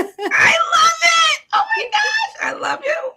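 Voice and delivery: very high, loud laughing squeal; slow, squealing fan scream; delighted shriek. Real, not acted. Host squealing over Australian greeting.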